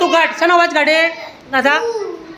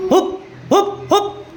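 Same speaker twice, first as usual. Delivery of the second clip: higher, short monkey hoops; monkey hoot; 4 semitones higher, 3 dB quieter, slower than usual. Shown as a demonstration. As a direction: hoot like a monkey, well spaced. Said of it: "વાંદરાનો અવાજ કાઢ". (વાંદરાનું હૂપ હૂપ)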